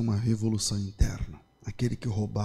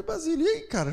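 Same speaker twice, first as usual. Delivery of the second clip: friendly greeting. Retold or imitated. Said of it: "Eu falei".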